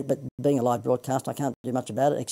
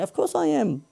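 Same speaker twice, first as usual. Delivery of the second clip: higher, sing-song stand-up lilt on "course"; Seinfeld inflection; 12 semitones higher than usual. Retold or imitated. Host answering his own pitch question, Seinfeld-style lilt. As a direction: rhetorical quip with a sitcom comedian's bounce. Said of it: "I did the inflection like Seinfeld".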